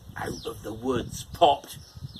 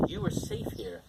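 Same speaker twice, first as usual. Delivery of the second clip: very high, softer comforting squeak; reassuring pig; 5 dB quieter than usual. Acted. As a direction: soothe frightened brothers. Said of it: "said the little pig".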